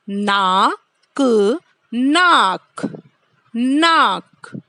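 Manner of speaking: नाक stretched very slowly with a long aa; drawn-out teaching नाक; slow syllable drill. Shown as a demonstration. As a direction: say नाक slowly for kids to copy. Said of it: "उच्चारण करना है इसका बार-बार".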